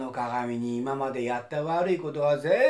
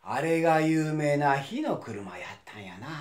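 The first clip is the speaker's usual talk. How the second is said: ordinary delivery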